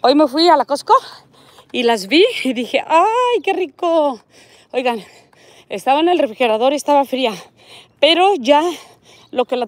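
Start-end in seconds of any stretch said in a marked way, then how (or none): 2.9-4.2 drawn-out, higher-pitched delight; delighted exclamation. Retold or imitated. Vlogger recalling her Costco find, delighted exclamation. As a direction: relive a craving with a long, high "ay".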